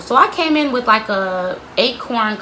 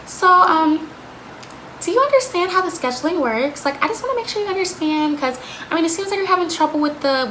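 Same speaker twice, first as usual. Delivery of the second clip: put-on higher, patronizing questions to a child; condescending manager; 6 semitones higher than usual. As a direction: talk down sweetly like to a kid. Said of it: "her little condescending shit".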